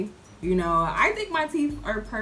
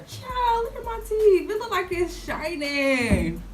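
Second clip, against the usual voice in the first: high excited exclaim; 10 semitones higher than usual. Real, not acted. Showing viewers her shiny teeth, high excited exclaim. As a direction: squeal it up high with delight.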